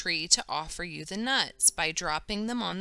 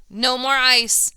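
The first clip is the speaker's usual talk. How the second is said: slow, loud, much louder shout; hollering; 6 semitones higher, 11 dB louder than usual. A narrator's reading. The cranky shopkeeper hollers at the girls, loud slow shout. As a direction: bark it across the shop, fed up. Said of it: "Mister Wong hollers".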